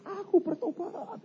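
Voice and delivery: very high, drawn-out mock cry; imitated scream. Preacher imitates a burning sinner crying out repentance, mock scream. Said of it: "Dia teriak".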